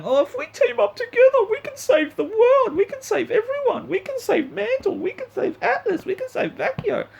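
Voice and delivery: very high, sing-song mock optimism; mocking naive Ruby. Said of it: "here you are going".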